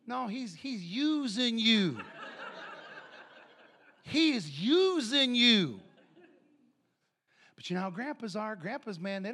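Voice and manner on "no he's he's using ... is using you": slow, loud, high laughing emphasis; amused warning to the crowd. Real, not acted. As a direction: spell it out through laughter.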